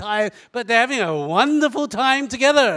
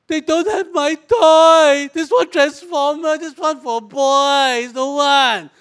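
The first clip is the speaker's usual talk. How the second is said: put-on whiny little girl; 4 semitones higher, 5 dB louder, slower than usual. Girl whining about missing toy.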